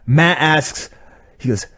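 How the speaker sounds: normal talking voice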